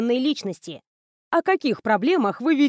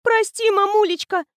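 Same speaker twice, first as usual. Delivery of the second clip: put-on higher, whimpering girl's plea; a tearful child begging forgiveness; sobbing apology; 9 semitones higher than usual. A narrator's reading. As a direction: daughter sobs to her mother. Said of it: "всхлипнула дочь".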